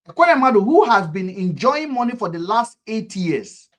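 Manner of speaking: shouted high and loud, then slowing; indignant outburst at a politician; outraged shout. Real, not acted. Angry rant about a corrupt senator.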